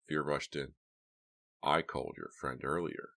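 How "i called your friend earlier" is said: ordinary delivery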